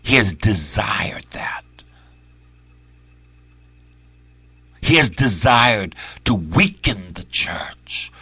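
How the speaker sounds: tense whisper; hushed urgent whisper. Real, not acted. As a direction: whisper the warning. Whispered warning in sermon.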